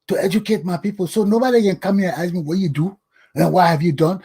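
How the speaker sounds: ordinary talk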